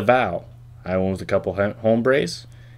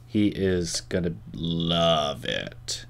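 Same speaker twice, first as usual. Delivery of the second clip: very slow and softer, savoring He is gonna love it; mock-seductive promise; 3 semitones lower, 6 dB quieter, much slower than usual. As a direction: draw it out low and teasing. Teasing promise about friend.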